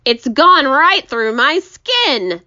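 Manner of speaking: slow, high, loud wail; hammed-up, drawn-out moan; groaned complaint. A narrator's reading. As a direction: groan it out in misery. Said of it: "the earthworm groaned".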